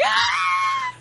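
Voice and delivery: very high shrieking mock scream; imitated scream. Retelling how she screamed at the studio, high shriek. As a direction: shriek like you got jumped. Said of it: "she was like".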